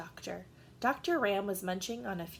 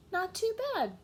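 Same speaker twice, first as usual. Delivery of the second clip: much higher, grudging approval; Gregory voice; 11 semitones higher than usual. Acted. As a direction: kid admitting it's okay. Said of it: "said Gregory".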